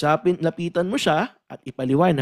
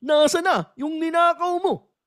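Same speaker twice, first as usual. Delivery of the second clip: higher, louder mock interrogator shout; interrogator yelling; 11 semitones higher, 3 dB louder than usual. Reviewer plays an interrogator shouting at the accused, hammed-up yell. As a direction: bark it at a suspect like a rough cop. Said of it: "let's say sabihin".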